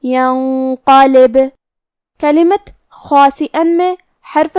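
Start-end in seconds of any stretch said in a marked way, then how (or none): 0.0-1.4 ینقلب with an echoing ب; tajweed demo. Shown as a demonstration. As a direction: bounce the final ب of ینقلب. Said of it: "با کو ہلاتے ہوئے یہ کلمہ کو پڑھنا ہے".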